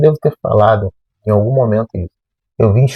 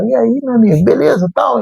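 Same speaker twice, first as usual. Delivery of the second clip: much higher, chummy hello; imitated friendly greeting; 11 semitones higher than usual. Likeable classmate greeting his new seatmate, imitated friendly manner. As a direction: greet a stranger like an old pal. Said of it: "gente fina, que é simpática".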